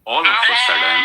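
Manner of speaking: loud, high burst of surprise; sudden shout. A narrator's reading. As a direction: jolt the listeners awake. Sudden turn in story. (અચાનક મોટેથી, ઉત્સાહભેર)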